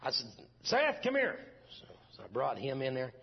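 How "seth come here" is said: high, loud call across the house. Retold or imitated. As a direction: call the boy in from another room. Calling son into the room.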